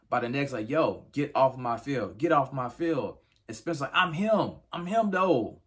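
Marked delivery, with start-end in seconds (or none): 0.7-3.2 angry coach. Retold or imitated. Coach throwing the player off the field, stern shout. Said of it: "roughs him up by the neck". 3.9-5.6 high, loud yelled boast; retold shouting. Player yelling at the coach during practice, loud boast. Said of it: "he ends up going ballistic".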